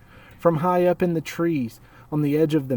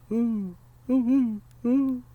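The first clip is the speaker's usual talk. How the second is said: faint echoing owl call; distant owl echo; 10 semitones higher, slower than usual. Acted. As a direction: answer like an owl far away. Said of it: "an echo came threading its way through the trees".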